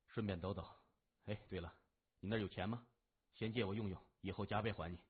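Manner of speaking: low, soft, offhand request; cool gang boss, casual. A narrator's reading. Gang boss asking policeman for a loan, casual and low. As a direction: ask casually in a low, quiet voice.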